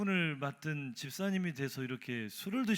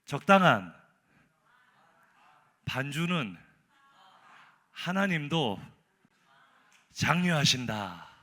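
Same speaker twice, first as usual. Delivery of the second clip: proud recital; 6 dB louder, slower than usual. Retold or imitated. Drunk deacon recites acrostic.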